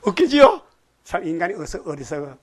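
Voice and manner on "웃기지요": high, laughing question through a chuckle. Real, not acted. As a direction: laugh while asking it. Preacher laughs at own joke.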